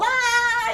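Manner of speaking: put-on high little kid's excited squeal; imitated child's scream. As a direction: mimic the toddler shrieking down the hall. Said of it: "the little guy's screaming down the hall".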